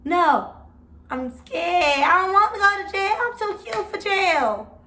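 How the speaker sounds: retold crying plea, rising to a high whine; sobbing to officers, scared of jail. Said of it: "So I'm crying like".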